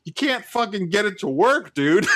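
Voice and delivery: high, loud exasperated retort; rising irate comeback to a rude customer; retold retort.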